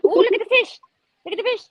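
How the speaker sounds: high, cute voice for the turtle. Pretend. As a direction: speak for the little turtle, squeaky and eager. Voicing a fish-watching turtle.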